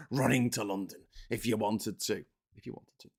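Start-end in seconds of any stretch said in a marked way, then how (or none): 2.6-3.1 barely audible whisper; whispered echo. Shown as a demonstration. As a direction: whisper it as a private aside. Whispered echo of own phrase.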